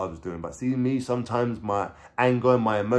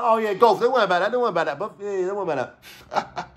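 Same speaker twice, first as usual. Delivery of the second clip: flustered brush-off; 8 semitones higher, faster than usual. Retold or imitated. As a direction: act out covering up a slip. Covering a slip in his lie.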